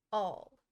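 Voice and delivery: All said loudly with heavy weight; great emphasis. A narrator's reading. King insists to the jury, stressing All. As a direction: lean hard on All. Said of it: "he repeated with great emphasis".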